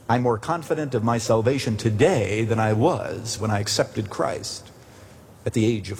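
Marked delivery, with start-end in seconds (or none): none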